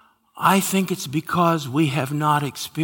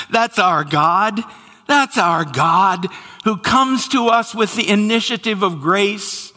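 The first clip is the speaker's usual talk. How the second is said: louder, exultant preacher declaration; proclaiming; 7 semitones higher, 4 dB louder than usual. Acted. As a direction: proclaim it with joy to the congregation.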